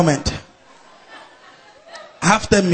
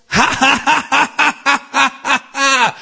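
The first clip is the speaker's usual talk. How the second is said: acted laughter; 6 semitones higher than usual. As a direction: imitate a long rolling laugh. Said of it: "laughed and laughed".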